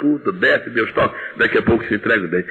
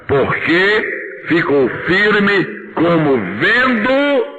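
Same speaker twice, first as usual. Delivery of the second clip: slow, drawn-out recitation in unison; slow reading together; 8 semitones higher, much slower than usual. Real, not acted. Preacher leads the church reading the verse slowly. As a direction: read it slowly with the congregation. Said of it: "Juntos agora".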